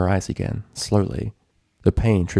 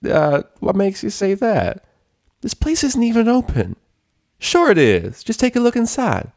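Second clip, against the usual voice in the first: nervous lying; 14 semitones higher, 3 dB louder than usual. A narrator's reading. Applejack stalling at the door, nervous and high. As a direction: fumble the cover story.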